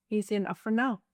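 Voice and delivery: ordinary delivery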